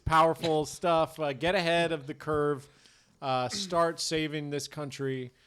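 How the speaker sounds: slow earnest sponsor plug; mock ad read. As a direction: sell it like a sincere spokesman.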